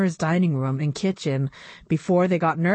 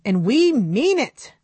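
firm and louder, laying down the law; stern; 6 semitones higher, 5 dB louder than usual. Acted. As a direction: say it like a parent who means it. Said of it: "Annabelle said sternly".

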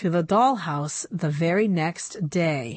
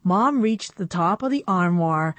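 usual speaking voice

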